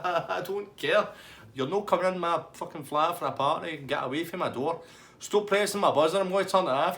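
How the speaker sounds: high, loud telling-off; mock furious woman. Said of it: "And she's like".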